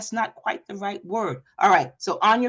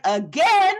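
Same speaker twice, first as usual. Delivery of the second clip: high, louder dismayed question; dismayed surprise; 9 semitones higher, 5 dB louder than usual. Retold or imitated. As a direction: client groaning at another take. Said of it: "She's like".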